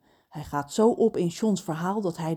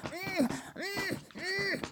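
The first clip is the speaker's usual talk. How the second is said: high, soft humming through a shut beak; panicky muffled hum; 11 semitones higher, 12 dB quieter, much slower than usual. A narrator's reading. Crow with a glued beak hums in panic. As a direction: panicky mm with the beak stuck closed. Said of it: "vanuit zijn keel klinkt een paniekerig".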